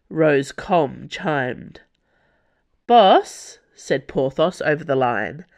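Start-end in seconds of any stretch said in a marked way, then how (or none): 2.9-3.6 loud, raised call, faintly ironic; hint of irony. A narrator's reading. Porthos calling the boss over the comm, hint of irony. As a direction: call out the title with slight irony. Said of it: "with only a hint of irony".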